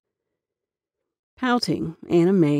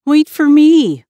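high, loud call after them; pleading call; 10 semitones higher, 11 dB louder, slower than usual. Acted. Boy calling after running cousins, high loud plea. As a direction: beg them to wait up. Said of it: "Humpy pleaded".

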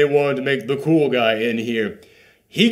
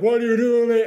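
high, frantic question; mock alarm, pitched up; a lightly acted startled onlooker; alarmed bystander; 9 semitones higher than usual. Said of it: "running around like".

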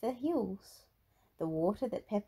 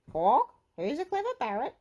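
high, loud parrot squawk; high-pitched parrot echo; copying parrot; 7 semitones higher, 6 dB louder than usual. A narrator's reading. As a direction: mimic like a pet parrot. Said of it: "Polly copied".

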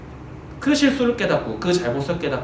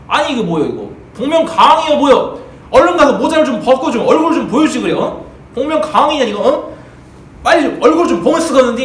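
mocking crowd; 6 semitones higher, 7 dB louder than usual. Crowd teasing Peter.

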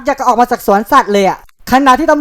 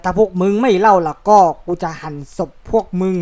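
lightly menacing threat; 5 semitones lower, 4 dB quieter than usual. Acted. Counselor threatens kids with murder.

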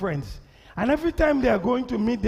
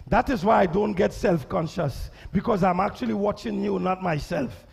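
ordinary delivery

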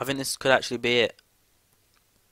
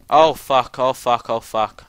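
loud repeated curse, falling in pitch; panicked swearing; 3 semitones higher, 12 dB louder, slower than usual. Real, not acted. Player watching things catch fire, loud panicked cursing. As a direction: blurt it out in alarm, loudly.

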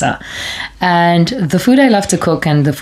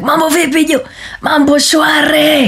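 Mombasa street greeting; 10 semitones higher than usual. Retold or imitated. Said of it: "when you walk, you're like".

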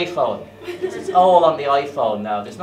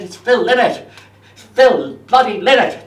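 louder, higher, indignant insistence; exasperated; 7 semitones higher, 9 dB louder than usual. Retold or imitated. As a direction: stress bloody in disbelief. Exasperated at the guru.

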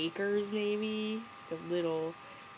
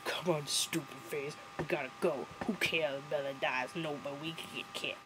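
lightly voiced gruff pack leader; hint of a grumpy impression; mock Sam; 3 semitones lower, 6 dB quieter than usual. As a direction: imitate a curt leader, a bit lower. Imitating Sam ordering the pack.

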